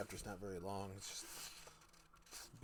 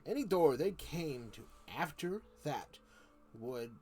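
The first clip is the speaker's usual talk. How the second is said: louder, measured reading voice; story narration; 4 semitones higher, 9 dB louder, slower than usual. A narrator's reading. Streamer reading Narnia to viewers, storytelling voice. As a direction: read the chapter aloud steadily. Reads the book aloud.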